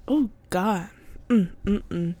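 swallowing tea, murmuring; savouring a sip of tea; while sipping a drink. Real, not acted. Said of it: "sip my tea like I'm about to".

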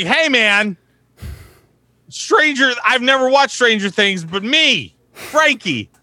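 goofy Frankie voice on a FaceTime call. Acted. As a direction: play Frankie, pushed up high. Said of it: "what inspired Frank's voice".

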